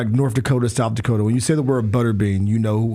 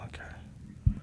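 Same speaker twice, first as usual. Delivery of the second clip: whispered; 15 dB quieter than usual. Real, not acted. Reacts to question.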